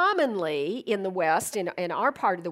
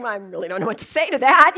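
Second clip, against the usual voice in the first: flustered rush; 10 dB louder, faster than usual. Retold or imitated. Flustered reaction.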